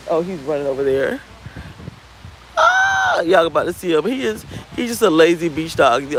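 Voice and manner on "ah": sudden high sustained "ah"; high-pitched cry. Real, not acted. Reacting to the dog running over, high squeal. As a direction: let out one high squeal.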